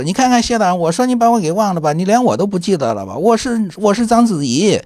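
high, coy mock-sweet imitation; teasing Ni Ping. Ni Ping pretends to be Zhang Ziyi to Xie Jin, high coy tease. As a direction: pitch it up and play the tease, lightly. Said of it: "这个倪萍说".

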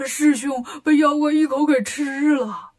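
slow tearful wail; crying. A narrator's reading. Bajie wails to his master, slow crying voice. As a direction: Bajie wailing, slow and weepy. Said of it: "八戒哭了".

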